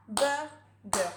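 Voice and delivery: "Hamburger" split slowly into its syllables; syllables spelled out. Shown as a demonstration. Teacher counting syllables with a child, slow syllable by syllable. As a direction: beat out each syllable of "Hamburger". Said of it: "Lui, ça marche".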